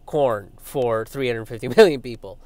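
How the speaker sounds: ordinary talk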